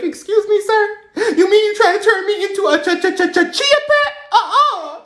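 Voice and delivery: very high, loud stammering squeal; outraged disbelief. Retold or imitated. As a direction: squeal in mock horror, stuck on the word. Shocked at order to shave head.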